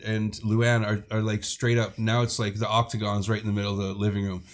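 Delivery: plain reading voice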